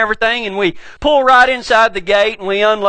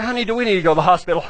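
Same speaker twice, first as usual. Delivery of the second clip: fast urgent yell; 4 semitones lower, 3 dB quieter, much faster than usual. Retold or imitated. Yelling over mosquito chaos.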